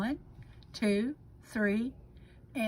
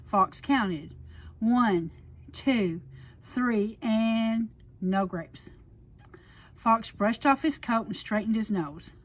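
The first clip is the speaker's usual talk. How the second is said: ordinary delivery, nothing marked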